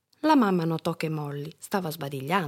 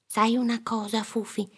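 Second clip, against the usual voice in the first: put-on confiding little girl; 3 semitones higher than usual. A narrator's reading. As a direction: share a bedtime thought with a pet. Girl confides in dog at bedtime.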